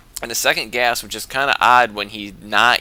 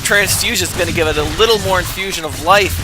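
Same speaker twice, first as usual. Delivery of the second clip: higher, louder rising battle hype; excited play-by-play; 6 semitones higher, 6 dB louder than usual. Real, not acted. Caster hypes up the spine crawler fight, excited.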